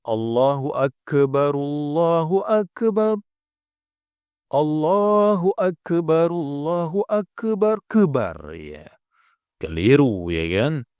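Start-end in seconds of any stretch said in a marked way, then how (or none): 0.0-8.4 Akbar with the k bounced as "Akebar", chanted slowly and higher; bouncing qalqalah kaf. Shown as a demonstration. Teacher shows the wrong qalqalah on kaf, slow higher chant. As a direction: add a little echo vowel after the k in Akbar. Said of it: "Ada yang membaca qalqalah".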